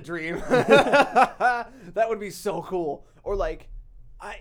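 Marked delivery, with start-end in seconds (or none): 0.4-1.9 loud, high delighted laugh; a big happy laugh; burst of laughter. Real, not acted. Laughing at dream job idea.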